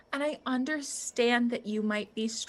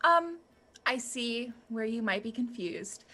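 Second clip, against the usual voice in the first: ordinary talk, nothing marked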